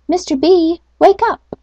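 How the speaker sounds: high bright wake-up call; owl calling out. Acted. Barn owl waking Mister B, high calling voice. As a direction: hoot him awake.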